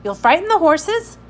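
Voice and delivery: high, loud commanding rebuke; strict woman barking at a tantrum; stern scolding. A narrator's reading. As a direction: scold the child to silence. Iron Woman scolding the boy.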